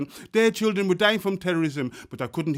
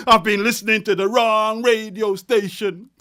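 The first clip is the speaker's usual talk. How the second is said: loud refrain; 5 semitones higher, 7 dB louder than usual. Acted. Refrain swelling near the climax.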